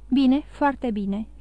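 ordinary delivery

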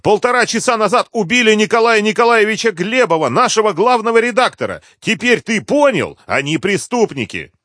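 high, loud, agitated outcry; shouted, strained high, easing at the end; crying out. A narrator's reading. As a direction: cry out the terrible news. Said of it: "вырвалась от неё и крикнула".

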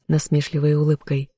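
usual speaking voice

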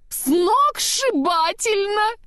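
very high, stretched-out rapture; exult, drawing the word out high; exultant. A narrator's reading. Snowman thrilled by his new nose, exultant. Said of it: "Снеговик ликовал".

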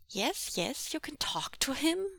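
put-on a small boy trying to sound grown-up; serious little boy. A narrator's reading. Boy passing the phone to his father, solemn and serious. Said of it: "Ah Ling was saying with a serious frown".

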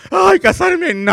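high and loud cry of refusal; long-time boyfriend dreading marriage, a high loud mock shriek; mock horror. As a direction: shriek it like a frightened groom.

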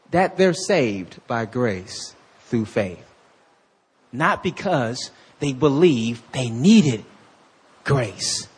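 slow, low, deliberate words; said slowly. Shown as a demonstration. Preacher slowing down so the congregation hears it. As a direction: spell it out slowly. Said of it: "I'm gonna say that slow".

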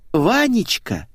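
mild high-pitched yell. A narrator's reading. Blonde calls the narrator back, understated yell. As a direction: call out high, without full volume. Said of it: "заорала Светик".

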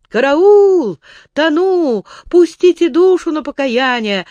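loud panicked cry for help; desperate drowning cry. A narrator's reading. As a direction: shout as if going under. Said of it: "молился он, выбиваясь из сил".

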